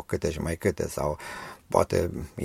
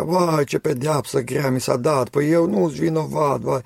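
much higher, louder, put-on complaining wail; whining prisoners; 10 semitones higher, 6 dB louder than usual. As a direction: moan that you are innocent, hammed up. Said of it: "Fiecare zicea".

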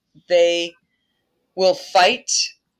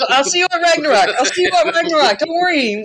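much higher and quick, cheerily dismissive; breezy Thor farewell; 10 semitones higher, faster than usual. Retold or imitated. Thor calls back to the wounded serpent, chirpy high and fast. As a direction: toss it off like a careless hero leaving.

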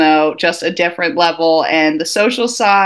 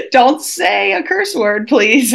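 higher, slow pleading; mock inner plea; 6 semitones higher, slower than usual. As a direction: beg yourself not to curse.

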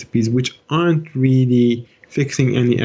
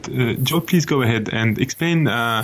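French accent. Shown as a demonstration. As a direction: put on a French accent for show. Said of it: "I'll do the French one".

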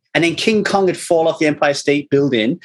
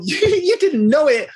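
ordinary talk, nothing marked

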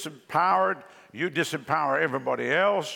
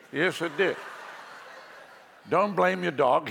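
normal talking voice

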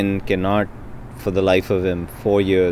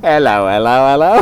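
mock Australian; 3 dB louder than usual. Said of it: "in a pub in Australia".

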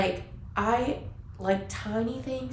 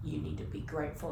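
stern scolding; 3 semitones lower, 10 dB quieter, faster than usual. Retold or imitated. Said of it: "my secretary being like".

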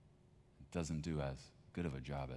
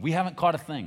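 loud, higher answer across the water; shouted reply; 11 semitones higher, 13 dB louder than usual. Acted. Fishermen shouting back to the shore, loud. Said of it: "To which they respond".

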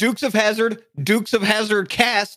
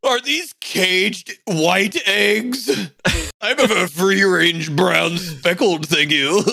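slow, snooty rich guy; much slower than usual. Retold or imitated. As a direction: look down your nose, slowly. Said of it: "That's my [name]'s rich guy voice".

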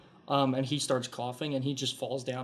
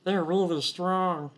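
slow, higher, impressed kobold voice; awed kobold; 5 semitones higher, 3 dB louder, slower than usual. Acted. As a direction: say it slowly in awe.

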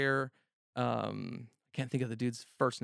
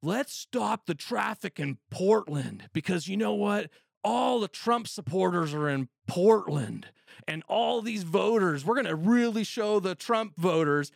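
higher, louder, slow sneering imitation; higher, louder mock of leftist protesters; 6 semitones higher, 6 dB louder, slower than usual. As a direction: mimic them as self-satisfied fools. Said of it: "Fucking geniuses".

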